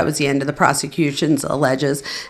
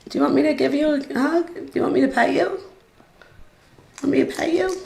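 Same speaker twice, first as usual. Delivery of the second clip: high, fast cooing to a pet; fast high pet-talk offering a hug; 9 semitones higher, faster than usual. Real, not acted. Cooing to her scared dog.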